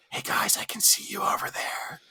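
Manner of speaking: hushed whisper to the staff. Retold or imitated. Calling softly to the waiters in the doorway, whispered. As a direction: breathe it out without voice, half sneaky. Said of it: "whisper talk".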